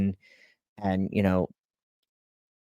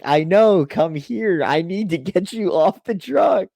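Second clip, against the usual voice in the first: raised, urgent call to an injured teammate; urgent retelling; 9 semitones higher, 4 dB louder than usual. Retold or imitated. Teammate to hurt driver, getting him off the truck, urgent. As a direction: re-enact calling someone over, high-pitched and pressing.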